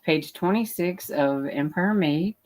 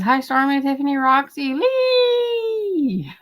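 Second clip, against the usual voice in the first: bright sing-song hello, rising high on the last name; greeting friends, voice jumping up at the end; cheery greeting; 8 semitones higher, slower than usual. Retold or imitated. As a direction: call out the names like a happy roll call. Reading chat greetings cheerfully.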